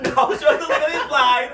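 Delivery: loud, high laughing denial; laughing protest. Real, not acted. Host denying co-host's tease about the manager, laughing protest. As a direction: protest through real laughter.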